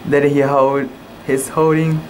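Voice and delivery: ordinary delivery, nothing marked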